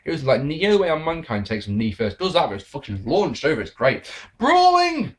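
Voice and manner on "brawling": much higher, louder mock cheer; sarcastic shout. Real, not acted. Reviewer sick of brawling, sarcastic high shout. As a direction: bellow it with fake glee.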